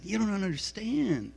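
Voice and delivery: put-on Blues Brothers Chicago accent. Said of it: "in his best Chicago accent".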